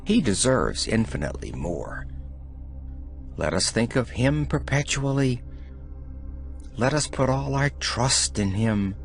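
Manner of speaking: usual speaking voice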